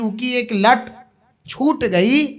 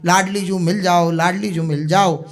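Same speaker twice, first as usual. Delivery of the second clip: normal talking voice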